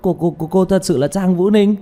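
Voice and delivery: high, stammering starstruck cry; wildly excited. Acted. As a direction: blurt it out in sheer amazement. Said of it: "vạn phần kích động". (la lên đầy kích động, lắp bắp)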